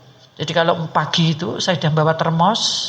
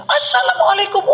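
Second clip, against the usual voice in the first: high phone greeting. Retold or imitated.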